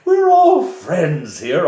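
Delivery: high, loud, slow friends here; loud oily Reeve. Acted. The Reeve insisting to the guards, loud and high. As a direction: push the all up high and loud.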